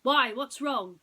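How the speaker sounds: loud, high boss snapping back; sharp demand. Acted. Boss demands of frightened Boris what's wrong, loud and sharp. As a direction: bark the question at him.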